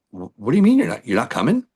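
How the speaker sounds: a stunned what-do-you-mean; incredulous question. Retold or imitated. Bass player refuses the tour.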